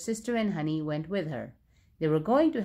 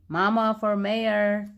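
mild shout; 3 semitones higher, 3 dB louder, slower than usual. A narrator's reading. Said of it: "He drove around town shouting".

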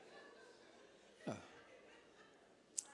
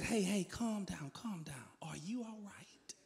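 soft, slow, hushed reassurance; calm her down gently, almost whispering; soothing husband; 4 semitones higher, 8 dB quieter, slower than usual. Retold or imitated.

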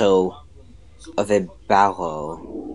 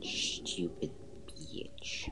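slow, breathy hushed insult; whispered insult; 6 semitones lower, 20 dB quieter, much slower than usual. Real, not acted. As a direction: hiss it under your breath. Muttered insult to enemy.

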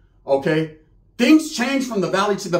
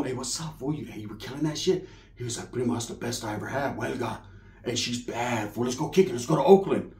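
low hushed talk; 7 semitones lower, 9 dB quieter than usual. Retold or imitated. Quiet exchange with primo.

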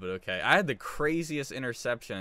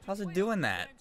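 high-pitched, louder baffled outburst; baffled disbelief; 11 semitones higher, 4 dB louder than usual. Real, not acted. Streamer sees own shirt glitching on camera, baffled disbelief. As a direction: exclaim it in real bewilderment.

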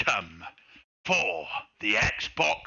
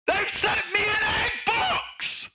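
high furious shout at the egg box; outraged yelling; 13 semitones higher than usual. Real, not acted. Streamer shouting at chat over a fake parcel. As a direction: yell in disbelief at the package. Prank victim ranting on stream.

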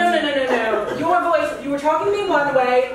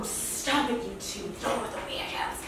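whispering under breath; whispered aside; 3 semitones lower, 11 dB quieter than usual. Real, not acted. Muttered aside.

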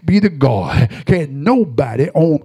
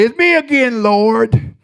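pleading prayer voice; 7 semitones higher, slower than usual. Acted. Preacher voices praying believer.

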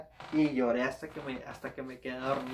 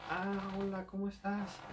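softer, slightly raised voice; subdued greeting; 4 semitones higher, 7 dB quieter, slower than usual. Retold or imitated. His reply on recognizing his ex.

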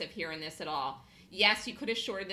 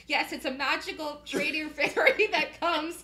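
laughing mock tale; 8 semitones higher, 9 dB louder than usual. Real, not acted. Joking to Eric about a trading fairy, laughing. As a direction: spin a silly fairy tale while laughing.

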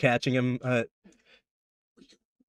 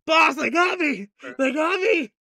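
much higher, louder cry for help; panicked yell; 13 semitones higher, 3 dB louder than usual. Acted. Captured beaver-man shrieking to his boss in panic. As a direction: squeal in alarm that you're caught. Grabbed NPC yells for boss.

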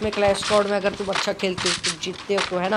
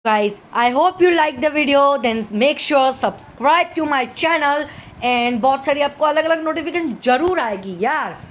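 slow outro; 5 semitones higher, much slower than usual. Acted. Outro appeal.

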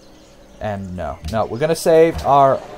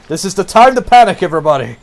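loud, high, hammed-up alarm; mock panic; 9 semitones higher, 9 dB louder than usual. Player telling everybody to panic, mock alarm. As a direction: playfully panic at the top of your voice. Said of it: "I'm panicking".

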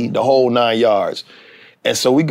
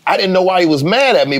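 normal talking voice

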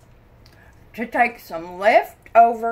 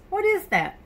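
surprised question; 11 semitones higher, faster than usual. Shown as a demonstration. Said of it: "second take much higher".

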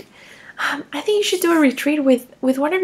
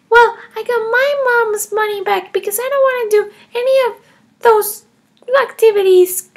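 put-on higher, defiant little girl voice; child Emma; 6 semitones higher, 5 dB louder than usual. Kindergartner Emma defending taking her mom's money, higher child voice. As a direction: play a cheeky kindergartner justifying herself.

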